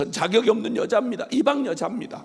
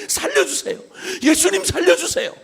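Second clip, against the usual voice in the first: high pleading cry; a mother's high-pitched cry for rescue; desperate plea; 8 semitones higher, 3 dB louder than usual. Retold or imitated. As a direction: beg for help with all you have. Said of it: "얼마나 간절했던지".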